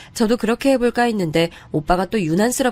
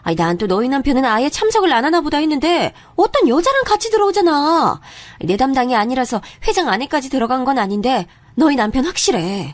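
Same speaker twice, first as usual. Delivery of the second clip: excited gossiping friend; 7 semitones higher than usual. Acted. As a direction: spill the news breathlessly. Friend reporting husband with woman.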